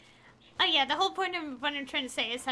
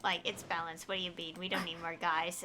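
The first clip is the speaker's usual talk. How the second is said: mock smug commenter, pitched lower; lower, smug parody of a know-it-all fan; 8 semitones lower than usual. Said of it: "I have seen people comment like".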